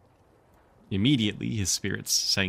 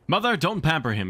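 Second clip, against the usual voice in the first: called after; 7 semitones higher, 3 dB louder than usual. Acted. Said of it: "he called after her".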